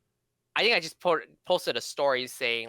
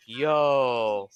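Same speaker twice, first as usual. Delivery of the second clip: calling out; 4 dB louder than usual. Retold or imitated. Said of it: "the guy was like".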